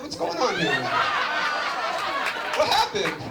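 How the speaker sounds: ordinary talk, nothing marked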